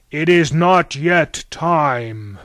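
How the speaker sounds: thundering, very slow, loud decree; Zeus. A narrator's reading. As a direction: draw out each word in a god's booming command. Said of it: "thundered Zeus".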